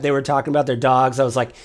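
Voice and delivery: ordinary talk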